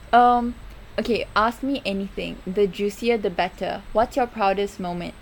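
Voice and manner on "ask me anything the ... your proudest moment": usual speaking voice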